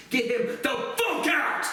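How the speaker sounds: slow, high furious shout. Real, not acted. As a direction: drag out every word of get him the fuck out. Raging at Peter Patton.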